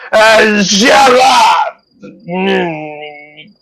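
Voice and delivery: put-on high, loud drunk yelling; drunken shout. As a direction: bellow it as he staggers off. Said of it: "stumbles away and goes".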